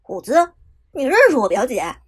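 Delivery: loud, quick shocked question. A narrator's reading. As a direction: blurt it out in disbelief. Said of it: "随后震惊问道". (震惊地问)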